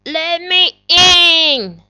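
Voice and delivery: wolf voice. Acted. Wolf asks pigs to open.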